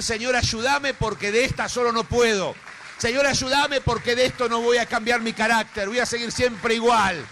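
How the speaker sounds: loud, high, desperate cry to God; pleading prayer. Acted. Preacher voicing a believer crying out to God, loud pleading. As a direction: beg the Lord for help at full voice. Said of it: "Empieza a decir".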